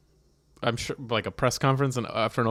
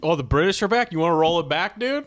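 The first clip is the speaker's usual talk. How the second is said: higher, louder, daring the redcoats; mock-tough Philly bro calling out the British, louder and higher; taunting challenge; 7 semitones higher, 4 dB louder than usual. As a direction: square up and taunt them.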